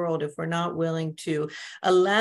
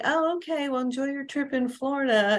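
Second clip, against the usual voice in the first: put-on old passive self; 7 semitones higher than usual. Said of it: "something in my old self".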